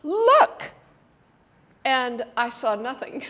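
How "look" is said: one high, loud drawn cry, imitating kids; excited children calling. Children showing mother their find in the woods, imitated excited shout. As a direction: mimic a child urgently pointing something out. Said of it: "and they said".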